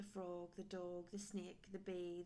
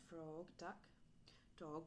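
plain reading voice

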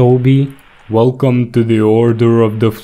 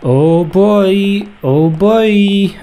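excited; 8 semitones higher, slower than usual. Real, not acted. Gamer reacts in high voice.

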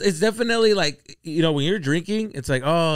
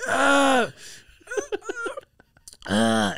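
pained groan; 12 semitones higher than usual. Retold or imitated. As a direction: re-enact a burning-throat groan. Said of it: "I remember going".

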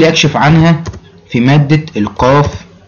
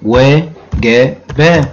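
teacher drawing out وجب for the class; drawn out slowly; much slower than usual. Shown as a demonstration. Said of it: "الماضي ... هيبقى".

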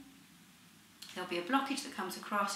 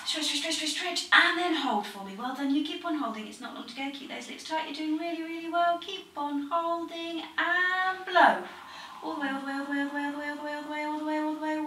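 higher, brisk encouraging calls; coaching; 6 semitones higher, 5 dB louder than usual. Real, not acted. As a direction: keep them holding, then blowing. Coaching breath hold and blow.

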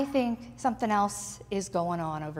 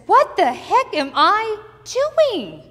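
startled Peter; 9 semitones higher, 8 dB louder, slower than usual. Acted. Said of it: "It's almost as if he says".

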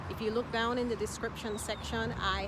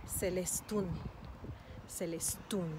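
Celestún landing on TÚN; teacher's model "Celestún", end-stressed; stress on last syllable. Shown as a demonstration. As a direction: hold back, then hit the final U of Celestún. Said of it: "emphasis is on the last syllable".

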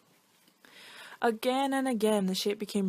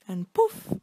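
poof popped higher and louder; magic poof; 6 semitones higher, 6 dB louder than usual. A narrator's reading. Narrator marks the shapeshifter's magic with a loud poof. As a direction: burst it out like a spell.

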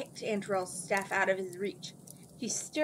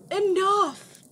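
much higher, much louder outburst; shouted enough; 12 semitones higher, 10 dB louder than usual. A narrator's reading. Wizard silences the mocking princesses with a shout. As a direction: roar to silence them all. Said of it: "Antorel cried".

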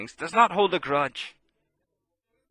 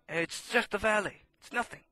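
soft dismissal; 2 semitones higher, 8 dB quieter than usual. Real, not acted. Preacher shrinking the problem for the faithful, soft hush. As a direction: drop to a near hush.

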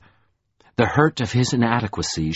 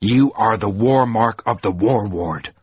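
dangerous warning. A narrator's reading. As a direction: warn him with cold danger. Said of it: "said Mahoram dangerously".

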